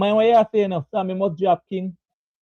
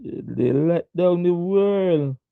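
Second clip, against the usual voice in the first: slow drawn-out verdict; much slower than usual. Real, not acted. Repeats the verdict slowly.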